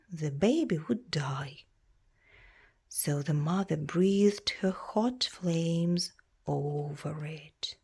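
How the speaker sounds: ordinary talk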